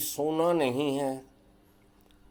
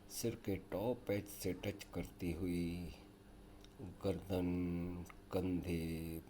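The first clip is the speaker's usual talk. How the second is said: guided yoga nidra; 5 semitones lower, 8 dB quieter than usual. Acted. Leads a yoga nidra relaxation.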